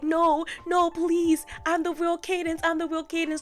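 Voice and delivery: high, loud panicked begging; hammed-up frantic plea; desperate pleading. Cadance begging for her life.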